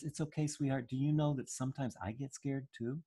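soft, tender reassurance; comforting mother. Retold or imitated. Mother holding her scared baby, softly reassuring. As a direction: hush the child's fear gently.

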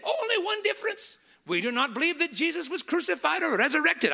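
put-on high, firm Middle Eastern accent. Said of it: "I gotta do the accent".

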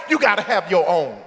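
ordinary talk, nothing marked

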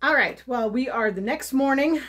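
ordinary delivery

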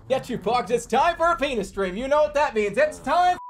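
high, excited hype-man voice; hyped-up welcome. Pretend. Streamer welcoming viewers to the stream, hyped up. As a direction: pump up the crowd, pitched high.

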